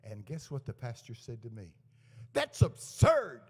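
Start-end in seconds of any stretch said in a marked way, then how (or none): 2.3-3.4 loud, high, drawn-out mock protest; indignant outburst. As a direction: act out an affronted pastor's objection. Pastor's indignant reply, acted out.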